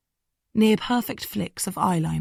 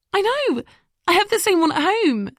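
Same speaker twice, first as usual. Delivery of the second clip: excited; 14 semitones higher, 4 dB louder than usual. Acted. Lily excited about shared books.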